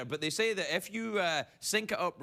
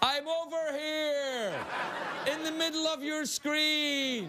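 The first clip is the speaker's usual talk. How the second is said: high, drawn-out mock call; 11 semitones higher, slower than usual. Host hailing viewers from an unlit studio, calling out. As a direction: stretch the words out high, as if hailing someone.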